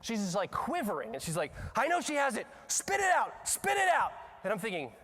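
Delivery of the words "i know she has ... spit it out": high accusation building to a shout; imitated teacher's yell. Second teacher rushing at quivering child, mock shouted order. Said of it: "another teacher comes flying up".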